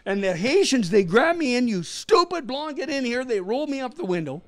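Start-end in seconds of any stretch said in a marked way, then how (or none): 1.7-3.2 high-pitched, exasperated retold order; mock scolding. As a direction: imitate an alarmed, cross scolding, pitched high. Haitians angrily haul him inside.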